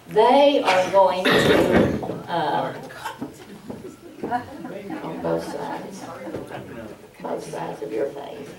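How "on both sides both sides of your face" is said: soft unvoiced aside; quiet aside, barely voiced; low aside. Real, not acted. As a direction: murmur it off to one person. Mic aside, off to someone.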